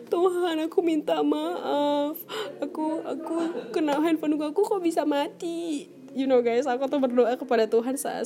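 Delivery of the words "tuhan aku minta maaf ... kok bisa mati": higher, slow pleading prayer, half-crying; tearful prayer. Retold or imitated. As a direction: beg God like a crying child. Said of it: "gue nangis". (doa memelas setengah menangis)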